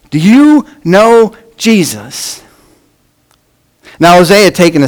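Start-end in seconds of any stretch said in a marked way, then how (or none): 0.1-2.4 earnest question. Retold or imitated. Bobby asks a sincere question.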